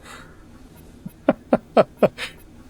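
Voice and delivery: high, breathy giggling; real laugh. Real, not acted. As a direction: giggle away helplessly at the game. Streamer reacts to begging character.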